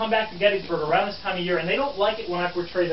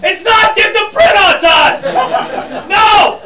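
slow, high, full-volume bellow; exasperated shout; 15 dB louder, much slower than usual. Acted. Reporter rejecting get the print on time, shouting. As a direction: throw the wrong answer back in a drawn-out shout. Explodes at wrong answer.

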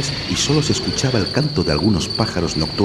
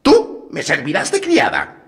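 wicked witch=122; 10 semitones higher, 3 dB louder, slower than usual. A narrator's reading. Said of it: "le dijo a Gretel".

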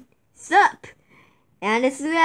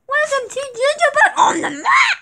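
high cheery gingerbread voice; cheery greeter voice; 9 semitones higher than usual. Acted. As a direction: greet them like a tiny cookie.

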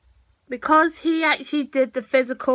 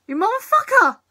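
higher-pitched indignant exclamation; high-pitched outburst; 7 semitones higher than usual. Acted. Vlogger cursing at a character, high-pitched outburst. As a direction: throw it out high. Repeats insult at episode, varied pitch.

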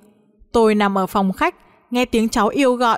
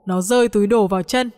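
ordinary delivery, nothing marked

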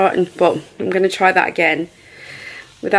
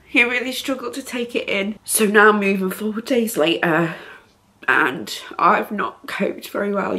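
ordinary talk, nothing marked

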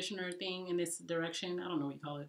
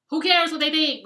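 much higher, louder dismissive cry; mom's exclamation; 9 semitones higher, 13 dB louder than usual. Retold or imitated. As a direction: brush it off loudly like an exasperated mom. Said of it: "my mom was just like".

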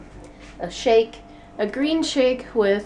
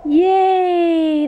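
excited cheer; 7 semitones higher, 7 dB louder than usual. Real, not acted. Cheering baby's block tower.

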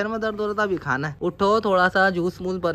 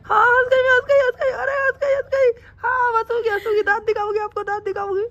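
high falsetto mock crying, louder; hammed-up wife whining; 14 semitones higher, 3 dB louder than usual. Husband hams up his wife's fake sobbing, high falsetto. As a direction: whine and sob like a sulking wife, hammed up. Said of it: "over acting करता है".

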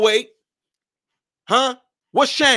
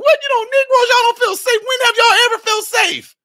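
much higher, louder, incredulous mocking voice; mocking laughing outsiders; 4 dB louder than usual. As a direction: ridicule them like the ones laughing at y'all. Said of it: "That's why they laughing at y'all. They're like".

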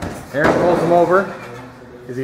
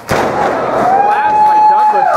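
high, drawn-out hype call; excited call; 4 dB louder, much slower than usual. Real, not acted. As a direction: stretch it out like a big play-by-play moment. Commentator calling a big move.

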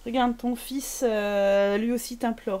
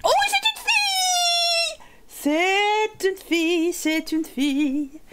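joyful announcement; 2 semitones higher, much slower than usual. Real, not acted. Streamer celebrating the newborn girl, stretched shout.

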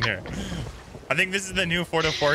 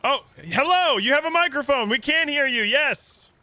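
high, raised voice hailing someone; calling out; 11 semitones higher, 3 dB louder than usual. Real, not acted. Telling the stranger we can hear you, calling out. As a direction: call over to a distant player.

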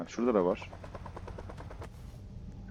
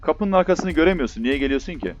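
panicked; 7 semitones higher, 5 dB louder than usual. Real, not acted. Monster coming toward hiding player.

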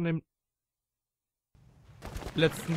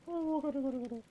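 scared; 11 semitones higher, faster than usual. Real, not acted. Scared by enemy in game.

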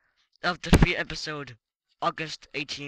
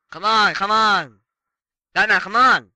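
frustrated yelling; 3 semitones higher, 9 dB louder than usual. Real, not acted. Yelling at stuck game partner.

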